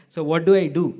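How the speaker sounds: loud, exasperated protest; retold exasperation. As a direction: demand an answer from the barber. Retold complaint to sleepy barber.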